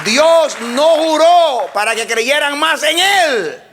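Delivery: high, loud, slow preaching of the verse; full-force preaching. Acted. Preacher proclaiming the verse to the congregation, full force. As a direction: proclaim the oath to the church.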